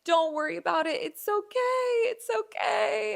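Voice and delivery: a hint of a soothing stylist; mock reassuring hairdresser; lightly voiced hairdresser. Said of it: "he just was like".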